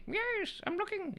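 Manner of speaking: high squeaky little sidekick voice; squeaky puppet voice. Acted. Sidekick puppet squeaks back at the host, high and small.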